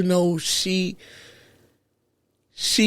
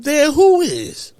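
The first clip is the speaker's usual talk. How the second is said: surprised; 10 semitones higher, 5 dB louder, slower than usual. Retold or imitated. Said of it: "And I said".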